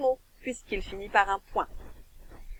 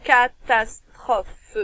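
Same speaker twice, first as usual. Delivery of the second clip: "Catastrophe" said very slowly after the syllables; teacher rebuilding the word after splitting it; slow whole word; 4 dB louder, much slower than usual. Shown as a demonstration. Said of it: "plusieurs syllabes".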